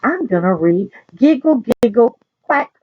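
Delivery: plain reading voice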